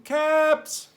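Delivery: high, loud peddler's cry; street calling. Acted. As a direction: call out wares down the street. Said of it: "he walked back to town calling".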